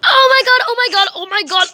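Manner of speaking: fast, high, breathless gasping at a friend; excited squeal. Pretend. Student spotting her best friend at school, fast excited squeal. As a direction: gasp it out like you just spotted your best friend.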